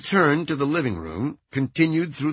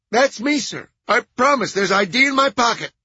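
loud, high anxious assurance; eager-to-comply nasal caretaker; high-pitched nasal man; 10 semitones higher, 6 dB louder than usual. A narrator's reading. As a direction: insist to the officer, high and nasal. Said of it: "High-pitched, nasal voice".

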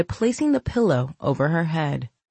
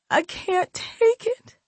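whimpering; slower than usual. Acted. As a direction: let it fade into a whimper. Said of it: "She continued the pity party".

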